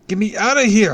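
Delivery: desperate high yell to be let out; shouting. A narrator's reading. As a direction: scream it at the top of your lungs. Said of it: "I shouted".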